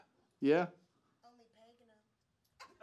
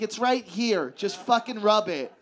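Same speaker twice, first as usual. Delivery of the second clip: impatient woman quote; 5 semitones higher, 6 dB louder than usual. Retold or imitated. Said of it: "And she's like".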